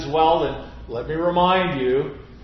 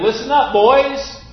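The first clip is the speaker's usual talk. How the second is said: higher and louder call, insisting; more emphatic; 9 semitones higher, 9 dB louder than usual. Retold or imitated. Teacher repeating his call more firmly to the boys. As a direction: demand attention, harder the second time. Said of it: "even more emphatically".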